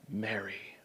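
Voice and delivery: very soft, low, gentle call; tender naming. Acted. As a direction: say the name almost under your breath. Jesus gently says her name.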